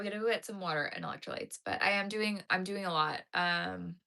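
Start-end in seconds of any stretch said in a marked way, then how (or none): none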